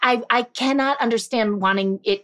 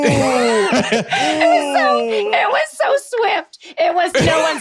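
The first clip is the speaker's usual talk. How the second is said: laughing; 11 semitones higher, much slower than usual. Real, not acted. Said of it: "laughing reaction to accent".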